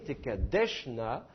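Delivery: Tekadeshna, lent, appui sur « desh »; détache « Tekadeshna » en accentuant « desh », pas le « na » final; accent sur l'avant-dernière syllabe. Shown as a demonstration. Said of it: "il n'est pas sur la dernière syllabe". (accentuation pénultième de « Tekadeshna »)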